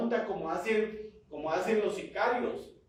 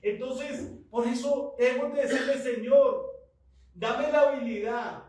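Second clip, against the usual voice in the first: loud, higher, impassioned sermon delivery; full-force preaching; 5 semitones higher, 5 dB louder than usual. Real, not acted. Preacher urging the congregation to connect with God, full force. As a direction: exhort the congregation with rising urgency.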